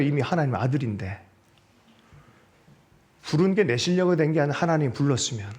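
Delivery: ordinary delivery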